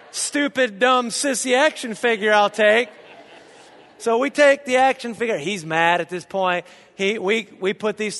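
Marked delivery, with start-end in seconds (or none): 0.1-2.9 put-on higher, louder cheeky kid reply; gleeful echo. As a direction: toss dad's words back with delight. Kids gleefully echo dad's insult.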